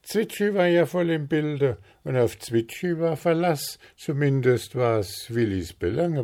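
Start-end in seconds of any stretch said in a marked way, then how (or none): none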